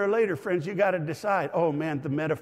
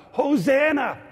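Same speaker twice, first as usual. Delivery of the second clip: high, loud acclaiming yell; excited crowd shout; 10 semitones higher, 7 dB louder, slower than usual. Acted. Crowd welcoming Jesus as he comes in, excited shout. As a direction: shout it out to the arriving king. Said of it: "they were saying".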